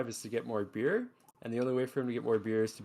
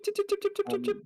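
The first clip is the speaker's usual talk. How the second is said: squirrel chitter; much faster than usual. Acted.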